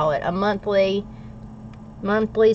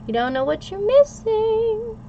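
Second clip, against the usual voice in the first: teasing; 13 semitones higher than usual. Acted. Teasing viewers about loaded grits.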